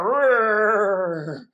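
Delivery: long, loud mock roar; playful held roar; bear roar. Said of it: "let's roar like the bear".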